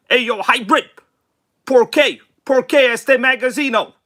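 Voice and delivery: high, loud mock Spanish-speaking fan; Armor vs. Traxxas. Streamer playfully mimicking a viewer asking why, high loud mock voice. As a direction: hail him in a put-on high voice, slipping into Spanish. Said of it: "Traxxas goes".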